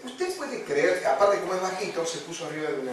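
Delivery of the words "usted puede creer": butcher's question. Retold or imitated.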